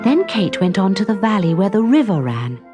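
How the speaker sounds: ordinary delivery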